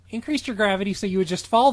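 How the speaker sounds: fast, rattled-off smug line; rushed redo. Acted. Stephanie explaining her spell in a quick, smug rush. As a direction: gabble it quickly and smugly. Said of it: "she laughed".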